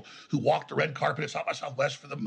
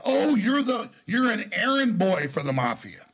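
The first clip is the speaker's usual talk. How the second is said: scornful retort; 5 semitones higher than usual. Retold or imitated. Indignant retort to lawyer's boast.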